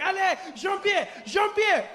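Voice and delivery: put-on high, shouted French-accented alarm; a French dad spotting the snake; panicked French tourist shouting. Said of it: "He's like".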